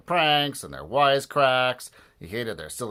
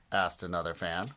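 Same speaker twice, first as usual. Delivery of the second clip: plain reading voice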